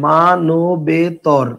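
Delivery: মানবেতর stretched very slowly, each syllable held apart. Shown as a demonstration. Teacher asking students to notice the word, slow syllable-by-syllable dictation. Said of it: "তাহলে খেয়াল করেন".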